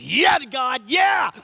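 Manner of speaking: high, loud shouted cheer; hammed-up whooping yell; mock cheering. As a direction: shout it up to God in mock triumph.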